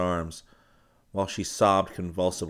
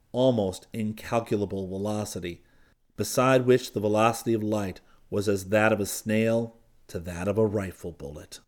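ordinary delivery, nothing marked

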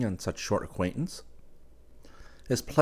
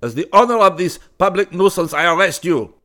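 stern arrest; 11 semitones higher, 11 dB louder than usual. Acted. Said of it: "He stormed up to the student".

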